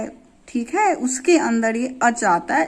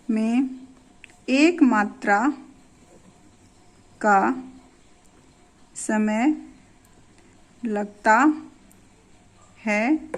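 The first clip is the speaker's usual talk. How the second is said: slow dictation. Shown as a demonstration. Teacher dictating the short-vowel definition, slow and word by word. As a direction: spell it out slowly for the class to write. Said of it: "repeats the definition for writing".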